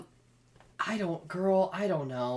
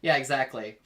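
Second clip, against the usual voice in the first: loud laughing agreement; laughing yeah; 3 semitones higher, 9 dB louder, much slower than usual. Real, not acted. Laughing at her cohost's teasing about the collar. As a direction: laugh through it.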